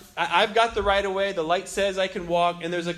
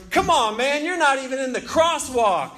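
loud, higher-pitched irritated yell; retold yell; 6 semitones higher, 4 dB louder than usual. Passenger to pedestrian in the crosswalk, yelling. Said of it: "a voice yells".

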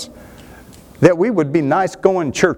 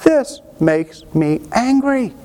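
slowed-down, pitched-up mock "This makes me angry"; drawn-out demo of righteous anger, every word stretched; slow, stretched indignation; 7 semitones higher, slower than usual. Shown as a demonstration. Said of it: "I'm just talking about".

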